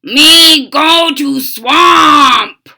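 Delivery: loud, high, slow bellow; booming slow frog monster. Acted. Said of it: "bellowed Frankenfrog".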